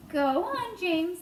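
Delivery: plain reading voice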